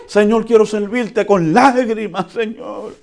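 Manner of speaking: high, loud pleading voice; hammed-up believer begging the Lord; mock tearful plea. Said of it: "a ese nivel de madurez".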